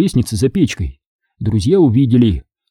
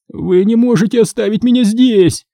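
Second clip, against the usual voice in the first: high-pitched pleading protest; 10 semitones higher than usual. A narrator's reading. Injured Michael begging friends not to leave him, protesting. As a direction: object in alarm, voice raised high. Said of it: "запротестовал Майкл".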